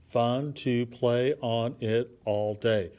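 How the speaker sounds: slow, evenly separated words in "fun to play on it all day"; syllable by syllable. Shown as a demonstration. Teacher checks for eight syllables, beat by beat. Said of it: "a syllable is kinda like a beat".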